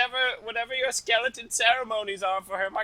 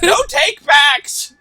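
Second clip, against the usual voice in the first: loud, slow yell with a cry in it; shouted claim; 10 semitones higher, 13 dB louder, much slower than usual. Acted. Spad shouting at Corveth not to take it back, loud slow yell. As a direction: bellow it out, drawn long and high.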